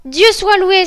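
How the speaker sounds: high, loud cry of thanks; exclamation. A narrator's reading. The sister sees rescue coming, exclaiming. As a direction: exclaim it in relief. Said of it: "s'écria-t-elle un moment après".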